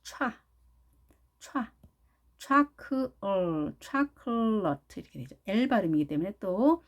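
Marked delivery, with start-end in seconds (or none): 0.0-5.0 stressed open "촤", then blended "차클러트" as chocolate; English pronunciation build-up. Shown as a demonstration. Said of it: "이렇게 발음되겠죠".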